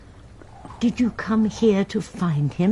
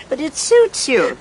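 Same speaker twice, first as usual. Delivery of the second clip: said through a light laugh, higher and louder; 7 semitones higher, 4 dB louder than usual. Acted. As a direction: tease her fondly while laughing. Teasing compliment through laughter.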